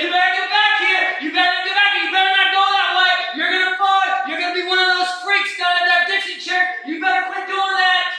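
high, loud shouted warnings; scolding naysayers. Acted. People trying to drag the walker down, shouted scolding. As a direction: yell at the walker to turn back. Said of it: "They say".

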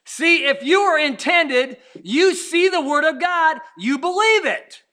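rising preacher cadence, building force; preaching. Acted. Preacher building to the congregation.

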